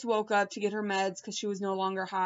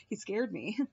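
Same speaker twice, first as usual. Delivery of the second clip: ordinary delivery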